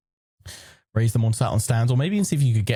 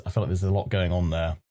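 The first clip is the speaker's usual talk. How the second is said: ordinary delivery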